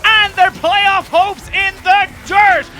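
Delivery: high, loud hype shout; drawn-out, excited shoutcast; esports play-by-play. Real, not acted. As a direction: stretch the big words out in a caster's roar.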